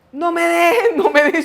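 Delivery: high, loud panicked cry; retold screaming. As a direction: act out a desperate scream not to be abandoned. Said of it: "Entonces empieza a gritar".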